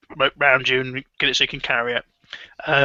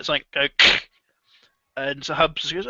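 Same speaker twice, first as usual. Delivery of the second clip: normal talking voice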